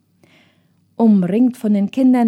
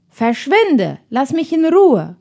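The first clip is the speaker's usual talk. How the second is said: curt, raised brush-off, rising on Ruhe; brusque; 4 semitones higher, 4 dB louder than usual. Acted. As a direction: snap at her to go away. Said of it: "trotz Tims barscher Antwort".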